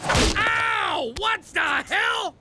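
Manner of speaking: sharp yell of pain and outrage; pained shout. Acted. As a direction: yell it out, stung. Hit by surprise, shouting.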